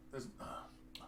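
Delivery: unvoiced whisper to self; whispering while looking. Real, not acted. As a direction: breathe it out while searching. Reading quietly to self.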